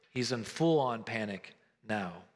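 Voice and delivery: ordinary talk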